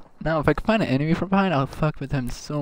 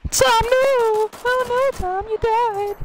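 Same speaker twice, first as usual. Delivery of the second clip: mock grief wail; 4 dB louder, much slower than usual. Mock grief over teammate dying.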